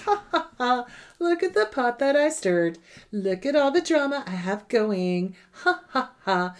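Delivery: hammed-up narcissist gloating over the mischief; gloating mock laugh. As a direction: mimic a smug schemer's stagy cackle. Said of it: "sits back and laughs and goes".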